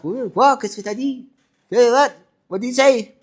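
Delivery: high-pitched, startled confusion; mock bewilderment, slipping into French; baffled reaction. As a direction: act out not understanding a word. Retold bafflement at Cockney English.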